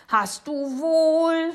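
long, high, drawn-out yell; shouting. A narrator's reading. Boy contradicting the neighbour, yelling drawn-out. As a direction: yell it back, stretching the last word. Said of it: "schreit Jonathan".

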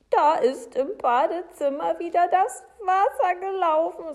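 high, loud insistence; agitated Frau Segebrecht. Acted. Woman insists on what happened.

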